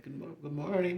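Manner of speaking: innocent sweet lady. Retold or imitated. Little lady greeting students all innocent, high and sweet. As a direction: act sweet and harmless. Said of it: "all innocent".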